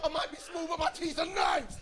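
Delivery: very high, squeaky London terrier; tiny London dog acting fierce in a squeak; London accent. Yorkshire Terrier protesting to its owner, squeaky London accent. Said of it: "they've got a London accent".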